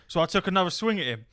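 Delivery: normal talking voice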